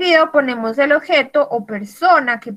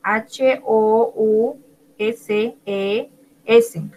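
slow spelling; much slower than usual. Shown as a demonstration. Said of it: "Sería con S al final".

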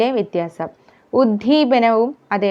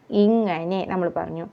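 ordinary talk, nothing marked

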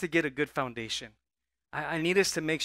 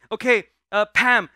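louder, higher boss giving orders; bossy boss; 4 semitones higher, 8 dB louder than usual. Retold or imitated. Boss ordering employee Pam, loud and bossy. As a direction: hand out the task like a demanding manager. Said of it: "your boss comes to you".

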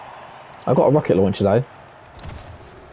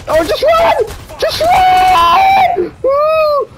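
high, loud screaming; panicked shriek; 3 dB louder, much slower than usual. Real, not acted. Panicked fleeing.

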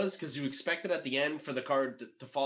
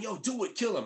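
higher-pitched imitation of egging someone on; mock urging; 8 semitones higher than usual. Acting out the order to Skank with the shotgun, mock urging. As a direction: urge him on in a raised voice.

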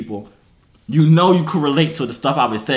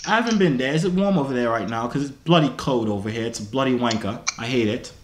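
put-on British; 2 semitones lower, 3 dB quieter than usual. Small talk about cold weather, put-on British accent. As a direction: grumble softly in fake British.

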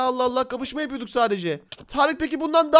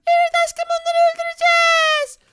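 put-on girly high spider girl voice; 4 dB louder than usual. (çok ince sesli örümcek kız)

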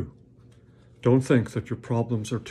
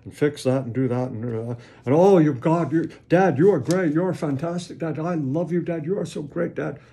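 put-on high, fast, breathless child rattling off a wish list then gushing praise; demanding child; 4 semitones higher, 4 dB louder than usual. Child pleads with his father, high, eager and gushing. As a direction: play a kid reading demands, then flattering Dad. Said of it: "He suddenly pulls out a list".

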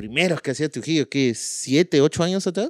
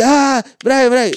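put-on whiny girlfriend; 11 semitones higher, 6 dB louder, much slower than usual. Girlfriend whining.

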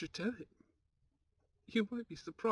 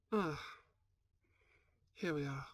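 usual speaking voice

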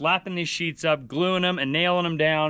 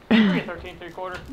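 auctioneer. Pretend. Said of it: "[name] sounds like an auctioneer".

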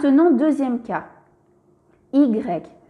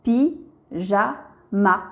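slow, split into pi, ja, ma; each syllable of "pi-ja-ma" said apart, slowly; much slower than usual. Shown as a demonstration. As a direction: sound out "pi-ja-ma" slowly for a child. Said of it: "Je lis".

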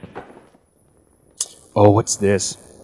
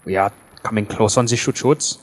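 put-on German accent; 3 semitones lower, 5 dB quieter than usual. Mock German persona.

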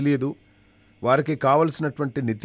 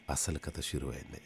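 ordinary talk

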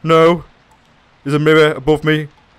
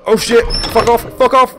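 panicked yelling. Real, not acted. Scared of bursting door.

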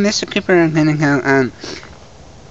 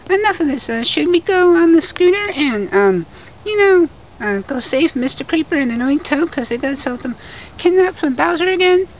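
high squeaky voice; 11 semitones higher than usual. Acted. Squeaky voice continues.

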